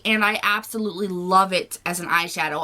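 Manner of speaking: ordinary talk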